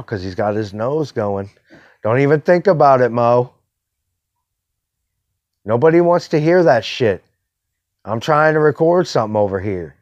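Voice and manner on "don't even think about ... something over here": real exasperated reprimand, louder; scolding the dog. Real, not acted. Podcaster to his dog while trying to record, scolding. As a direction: raise your voice to warn off a barking dog.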